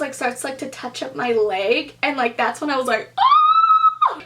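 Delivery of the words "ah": long, high, loud "ah"; alarmed squeal. Retold or imitated. Woman reacting to a stranger touching her leg, alarmed squeal. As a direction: hold a piercing high cry of alarm.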